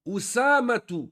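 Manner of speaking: usamatu with the opening u sounded, slowly; hamza pronounced. Shown as a demonstration. Teacher showing the class the sounded hamza in usamatu. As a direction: draw out the first u of usamatu. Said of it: "Qu'est-ce que c'est usamatu".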